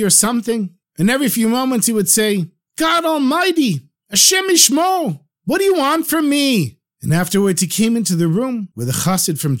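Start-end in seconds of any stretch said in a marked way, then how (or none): 2.8-6.8 upset, half-crazed man. Acted. Master of the house pleading with God, upset and high-pitched. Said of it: "He was upset, and it was as if he was drunk or crazy".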